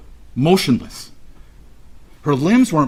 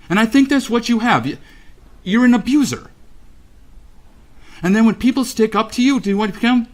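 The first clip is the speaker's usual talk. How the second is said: high-pitched, real indignation; angry accusation; 8 semitones higher, faster than usual. Real, not acted. Parent calling the defendant an abuser, angrily accusing.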